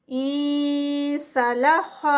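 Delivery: انسلخ drawn out with a hidden nasal نون; slow Quranic recitation. Shown as a demonstration. Teacher recites the word slowly for the class. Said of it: "خا heavy".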